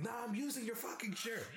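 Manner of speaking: high taunting shout; defiant yell. Retold or imitated. Roommate taunting him, high defiant shout. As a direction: refuse him with a sneer.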